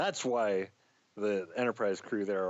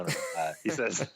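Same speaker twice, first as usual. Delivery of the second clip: normal talking voice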